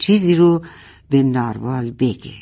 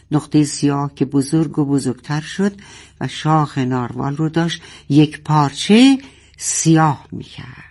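ordinary delivery

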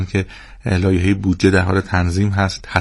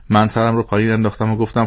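ordinary delivery, nothing marked

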